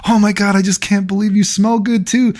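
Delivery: high girly mock voice; imitating a gushing girl. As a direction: squeal it like the excited girl.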